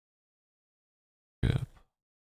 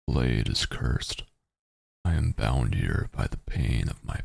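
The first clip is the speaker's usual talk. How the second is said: narrating a text adventure; 4 semitones lower than usual. Acted. Reads text adventure aloud.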